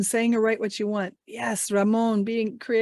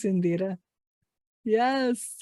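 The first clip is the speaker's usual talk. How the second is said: normal talking voice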